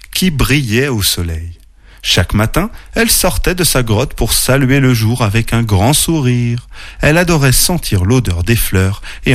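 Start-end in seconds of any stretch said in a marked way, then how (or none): none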